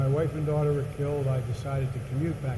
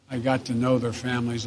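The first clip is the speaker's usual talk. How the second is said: ordinary talk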